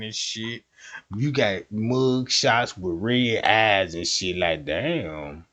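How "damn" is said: normal talking voice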